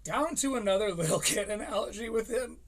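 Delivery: ordinary delivery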